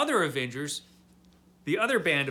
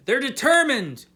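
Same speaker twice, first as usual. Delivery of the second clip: much higher and louder on the repeat; emphatic; 8 semitones higher, 8 dB louder than usual. Real, not acted. Summing up the heroes' resolve, louder and higher. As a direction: punch it like a rallying cry.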